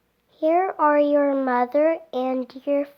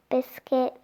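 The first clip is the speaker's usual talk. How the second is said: ordinary delivery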